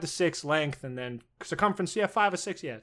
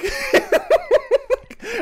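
big high burst of laughter; loud laugh; 8 dB louder than usual. Real, not acted. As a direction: howl with laughter. Howling at soda can joke.

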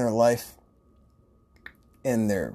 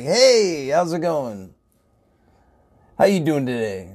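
higher and louder, bursting with cheer; bubbly greeting; 4 semitones higher, 5 dB louder than usual. Retold or imitated. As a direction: pop out and greet like a party host. He pops out greeting customers.

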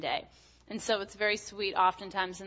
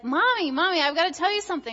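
put-on higher and louder, an eager little boy bursting with news; excited little boy; 8 semitones higher, 6 dB louder, faster than usual. Son running to Mommy with big news, eager child voice. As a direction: play the toddler who can't wait to tell Mommy.

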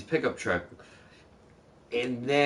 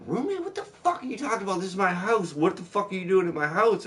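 high mock old lady outrage; hammed-up old lady, high-pitched indignation; furious old lady; 8 semitones higher than usual. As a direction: squeak roommate high, then tear into him. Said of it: "And she's like".

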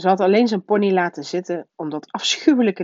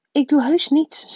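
put-on high, loud child's voice; protesting boy; 10 semitones higher, 5 dB louder than usual. A narrator's reading. Harry answers his uncle's warning, protesting.

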